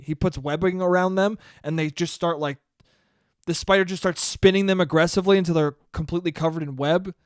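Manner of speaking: ordinary talk, nothing marked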